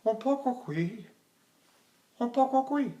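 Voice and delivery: soft, high, measured Spanish-singer voice. Retold or imitated. Famous tenor answering how he sings, soft high voice. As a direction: say it small and delicate, pausing between phrases. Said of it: "He said".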